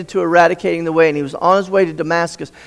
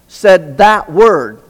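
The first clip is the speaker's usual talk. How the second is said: said that word drawn out slowly, each word weighed and louder; slow emphasis; 6 dB louder, much slower than usual. Shown as a demonstration. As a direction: lean hard on "said that word", slowly, with pauses. Preacher stressing the key word.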